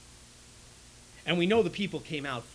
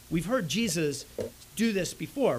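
normal talking voice

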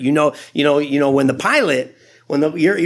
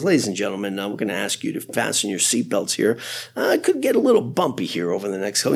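calm pilot announcement; 7 semitones lower, 4 dB quieter, faster than usual. Retold or imitated. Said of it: "he says it in that kind of voice".

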